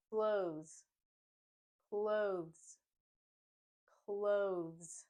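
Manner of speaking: Clothes said like "cloze", strong z ending; th skipped in clothes. Shown as a demonstration. Teacher modelling clothes for learners, th skipped. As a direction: drop the th, buzz the z in clothes. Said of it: "Let's try saying the word a couple of times".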